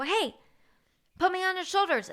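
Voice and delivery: excited plea. Retold or imitated. Begging a stranger at concert.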